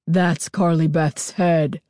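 put-on a masked girl's eerie rasp; deep throaty rasp. A narrator's reading. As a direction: rasp it out like something inhuman. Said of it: "a deep, throaty rasp".

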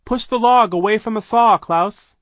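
high, very loud command; urgent shouted order. A narrator's reading. Sister orders Klaus away from the saw, shouting. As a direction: shout it across to him.

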